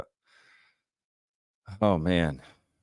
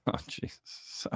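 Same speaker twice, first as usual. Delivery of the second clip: breathless laugh; 14 dB quieter, slower than usual. Real, not acted. Cracking up at a comment.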